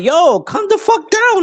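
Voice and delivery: high-pitched, slow, incredulous yelp; mock-exasperated high cry; exasperated outburst. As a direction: squeak it out in disbelief at their fuss. Retold outburst at emotional players.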